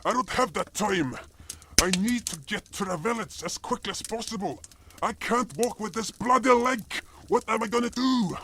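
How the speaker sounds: high, anxious, hurried pleading; panicked complaint. Acted. Cop panics to healer about swollen leg, high and anxious.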